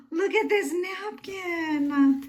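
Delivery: very slow, high gushing; delighted squeal. Real, not acted. Delight at gift napkin.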